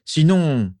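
high, forceful angry start; spat out angrily. A narrator's reading. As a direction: burst into an angry argument. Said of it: "éructa Rogue". (éclat de colère)

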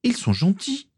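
petite fille qui proteste, voix très aiguë; protestation de fillette. A narrator's reading. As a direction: plaide d'une voix haut perchée d'enfant. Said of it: "protesta Morgan". (petite sœur protestant, ton très aigu)

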